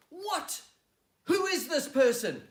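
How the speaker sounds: loud, high furious shout; outraged king. Acted. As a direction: explode in royal outrage. Outraged king stands and demands.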